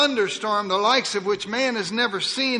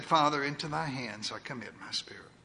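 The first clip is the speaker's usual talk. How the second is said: soft whispered last words, lower; quiet whisper; 5 semitones lower, 8 dB quieter than usual. Acted. Dying Jesus giving his spirit to the Father, hushed whisper. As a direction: breathe it out as a final surrender. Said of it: "quietly bowed and said".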